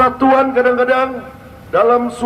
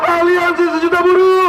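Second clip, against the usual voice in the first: fiery revolutionary speech; 5 semitones higher than usual. Real, not acted. Rally leader leads a chant.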